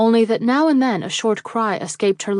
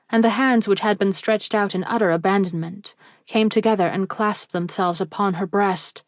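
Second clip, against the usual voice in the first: plain reading voice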